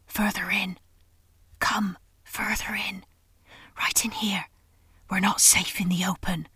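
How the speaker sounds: a rough, throaty whisper, drawn back and wary; hoarse throaty whisper. A narrator's reading. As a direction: rasp it out under the breath like a nervous beaver. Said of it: "in a hoarse, throaty whisper".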